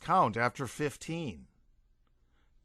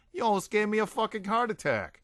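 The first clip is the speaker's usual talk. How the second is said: screaming; 8 semitones higher, faster than usual. A narrator's reading. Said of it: "screamed Derek".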